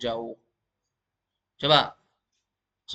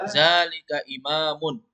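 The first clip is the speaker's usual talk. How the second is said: slow, deliberate answer; teacher modelling slowly; slow model reading; 4 dB louder, slower than usual. Shown as a demonstration. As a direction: spell it out slowly for the class. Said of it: "Coba ulangi".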